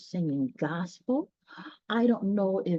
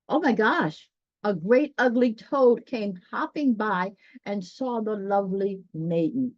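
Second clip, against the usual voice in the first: a little louder, building suspense as the toad comes; dramatic story reading; 3 semitones higher, 4 dB louder than usual. A narrator's reading. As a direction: read it like a spooky moment for kids.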